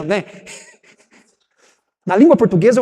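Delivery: ordinary talk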